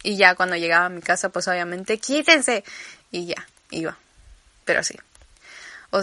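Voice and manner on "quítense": urgent shout. Retold or imitated. Rushing home to bathroom.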